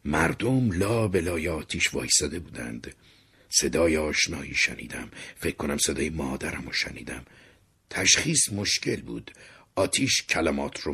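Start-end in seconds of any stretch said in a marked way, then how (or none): none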